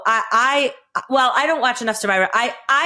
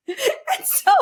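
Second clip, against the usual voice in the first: laughing; 13 semitones higher, 5 dB quieter than usual. Real, not acted. Laughing after the cameraman quote.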